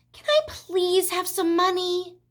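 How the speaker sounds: high, slow, drawn-out plea; pleading child. A narrator's reading. Fern asking her mother for money, pleading. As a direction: wheedle like a little girl.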